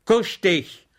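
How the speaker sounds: sharp loud shout at the dog; shouted command. A narrator's reading. Schweig shouting down the cowering dog, loud. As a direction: bark the order down at him. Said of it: "schrie er den Ärmsten an".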